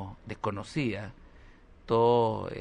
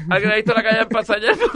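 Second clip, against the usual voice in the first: higher, louder snobby question through laughter; laughing posh question; 9 semitones higher, 8 dB louder than usual. Pretend. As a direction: ask the beach grandly, cracking up. Pregunta pija fingida. (pregunta pija exagerada entre risas, aguda y fuerte)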